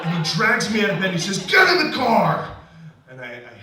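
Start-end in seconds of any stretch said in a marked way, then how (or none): 1.5-2.5 loud, high-pitched yelled command; explosive drunken-dad order; shouted order. Retold or imitated. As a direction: bellow it like a furious dad. Retold father dragging him out.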